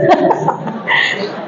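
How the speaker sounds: soft breathy laugh after a joke. Real, not acted. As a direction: chuckle quietly at your own teasing. Se ríe de su broma. (risa suave)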